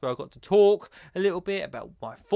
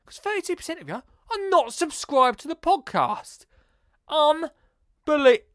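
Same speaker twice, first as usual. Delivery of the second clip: mock outrage; 10 semitones higher, 4 dB louder than usual. Scolding non-subscribers.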